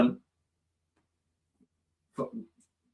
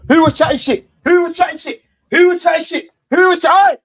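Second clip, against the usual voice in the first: taunting shout; 12 semitones higher, 10 dB louder than usual. Real, not acted. Taunting after the equaliser.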